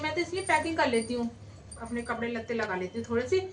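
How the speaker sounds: low, soft grumble to herself; grumbling. Acted. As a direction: mutter your complaints under your breath. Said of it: "कैसे बड़बड़ा रही हो".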